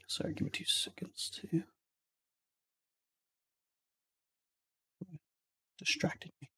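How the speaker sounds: hushed whispered aside; whispering. Real, not acted. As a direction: whisper it away from the table. Aside to table, off-mic.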